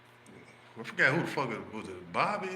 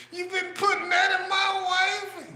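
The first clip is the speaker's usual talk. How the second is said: much higher, louder, very slow screech; mock outraged scream; 4 dB louder than usual. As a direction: stretch out the insult in hammed-up fury. Friend shrieks accusation.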